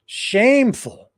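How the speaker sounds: drawn out in indignant disbelief; outraged. Real, not acted. Host condemning treatment of reporter, loud indignant cry.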